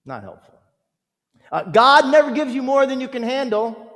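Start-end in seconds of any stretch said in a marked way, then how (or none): 1.5-3.9 high, loud, sing-song pious voice; mock platitude. Mocking a friend's empty comfort, high and loud. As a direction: parody a glib comforter. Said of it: "crass statements like this".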